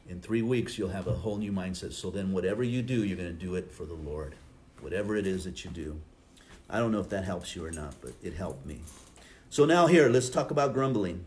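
normal talking voice

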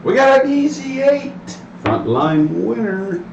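craps table caller. Real, not acted. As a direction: announce the roll to the whole table. Dealer calls the roll.

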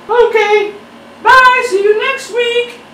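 chirpy high goodbye; back talking. Acted. Creator's back saying goodbye to viewers, high falsetto.

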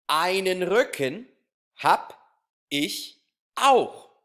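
slow, loud, measured poem reading for a class; poem recitation. Shown as a demonstration. Teacher reading a body-parts poem to pupils, slow loud recitation. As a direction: recite it line by line with long pauses and strong beats.

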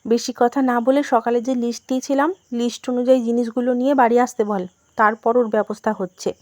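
ordinary delivery, nothing marked

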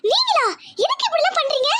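high-pitched, pained disbelief; shocked. Acted. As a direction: question the betrayer in shock. Learns who spiked the food. (அதிர்ச்சியான குரல்)

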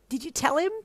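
high, loud eager question. A narrator's reading. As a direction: press a friend for gossip. Excited friend presses for gossip.

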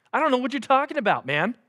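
high, quick, feigned cluelessness; playing daft. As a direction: deny it fast and high, acting clueless. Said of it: "He's playing daft".

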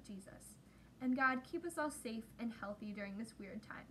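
soft, earnest prayer voice; closing prayer. Acted. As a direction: pray gently for the children.

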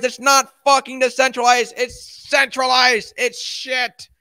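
high, loud furious ranting; real rage at Cardano, spat out slowly; triggered rant. Real, not acted. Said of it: "I'm so triggered".

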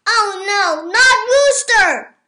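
turkey panicking for his friend; panicked cry. A narrator's reading. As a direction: cry out in alarm.

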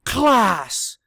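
loud, high roar of class; the trainer roaring at the class. Acted. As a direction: roar to call the class to order. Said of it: "roared the trainer".

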